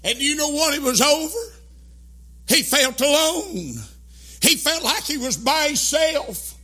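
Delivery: loud, driving sermon cadence; fiery preaching. Acted. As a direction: preach it hard to the congregation. Preacher building to a climax.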